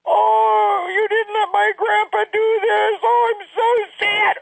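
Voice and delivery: high, slow, whiny mock sobbing; over-the-top pretend wail about grandpa; fake cry. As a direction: ham up a fake crying fit like a little girl. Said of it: "She knows how to do it like fake laugh or fake cry".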